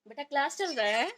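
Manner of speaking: loud, high call across the room; calling out to son. Real, not acted. Mother calling her son to his online class, loud and high.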